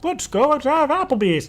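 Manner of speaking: lightly mock your friend's suggestion; light impression of a friend; imitating Eric. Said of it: "when Eric's like".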